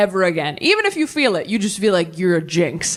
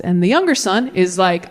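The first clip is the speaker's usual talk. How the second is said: usual speaking voice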